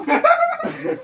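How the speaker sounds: real amused laugh; high laugh. Real, not acted. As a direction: burst of high-pitched laughter. Laughing at own story.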